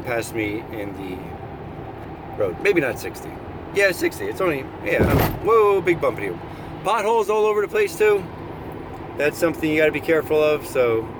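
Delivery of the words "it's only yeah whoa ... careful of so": usual speaking voice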